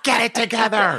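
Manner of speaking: high, forceful heckle; mock screaming dog. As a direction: play the dog telling someone off. Joins in voicing the screaming dog.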